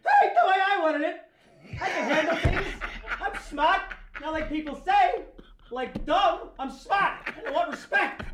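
Fredo yelling. Acted. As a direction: scream for respect like a broken brother. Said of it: "the whole boat scene".

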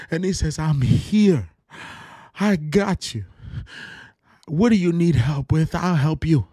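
fervent, high, loud preaching; preaching at full force. Real, not acted. Preacher on his podcast, caught up in it, preaching hard. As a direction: preach it with everything, pitch way up. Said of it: "I did not expect to get this worked up".

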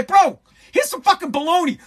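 mock outraged yell. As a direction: snap it out like you're fed up. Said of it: "I'd say".

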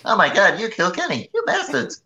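high kid cartoon voice; South Park voice. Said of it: "South Park quote".

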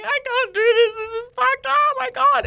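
high, loud, crying panic; imitating panicked sobbing in labor. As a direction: wail that you can't go on.

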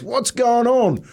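high, slow drawn-out call from another room; slow high suspicious dad's call. Retold or imitated. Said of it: "my dad ... goes".

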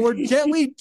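ordinary delivery

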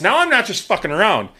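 ordinary delivery

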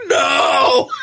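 shocked laughing shout of no. Retold or imitated. To the old Hawaiian shaver, a laughing disbelieving shout. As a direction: blurt it out mid-laugh in disbelief.

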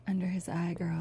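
hushed, voiceless whisper; mock Handmaid's Tale whisper. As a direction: breathe it like a Handmaid's secret. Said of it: "Kelsey's like".

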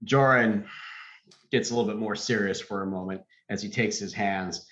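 ordinary delivery

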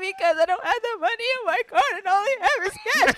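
high, tearful imitation of own sobbing; retold crying. To her mom on the phone, late and stuck, imitated sobbing. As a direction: replay the panicked breakdown, voice breaking. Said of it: "I just broke down in tears".

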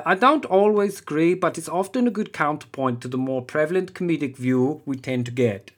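a hint of Henning Wehn's German delivery; Henning Wehn impression. Comedian reading a review as Henning Wehn in German accent. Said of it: "an impression of Henning Wehn".

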